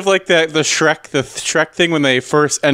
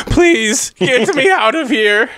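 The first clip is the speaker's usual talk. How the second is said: desperate plea; 6 semitones higher, slower than usual. Acted. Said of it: "the accent drops".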